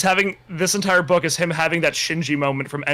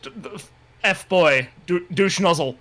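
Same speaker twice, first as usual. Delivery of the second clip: hammed-up British accent with faltering starts; bad British accent; 4 semitones higher than usual. Reader voicing a character's compliment in a bad British accent. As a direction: put on a lively, badly faked British accent. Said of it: "I'm gonna stop with the British accents".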